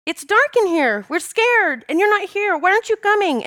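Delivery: high, pleading imitation of a frightened child; scared little girl. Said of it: "this is kinda what she was saying".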